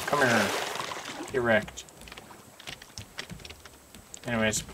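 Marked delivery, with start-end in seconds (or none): none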